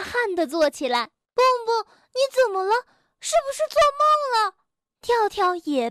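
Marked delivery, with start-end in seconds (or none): 1.3-4.6 worried friend. A narrator's reading. Worried friend wakes Bengbeng.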